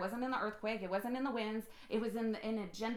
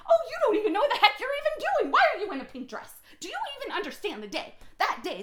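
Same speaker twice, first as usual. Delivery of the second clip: much higher and louder, fast, laughing mockery of a prude; mocking religious critic; 11 semitones higher, 9 dB louder, faster than usual. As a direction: lampoon the scolding churchgoer through laughter. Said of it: "the religious minds, they can't handle it".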